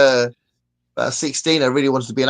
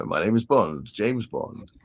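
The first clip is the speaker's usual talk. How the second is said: Roger Moore impression; 5 semitones lower, 6 dB quieter, slower than usual. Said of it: "I'm sounding like Roger Moore".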